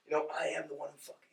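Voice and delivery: very soft, intimidating delivery; quiet menace without raising the voice. Retold or imitated.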